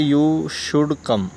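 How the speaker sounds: very slow, each word spaced apart; slow dictation. Shown as a demonstration. Teacher to class, slowly modeling the English sentence. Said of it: "तुम्हें आना चाहिए को हम क्या कहेंगे".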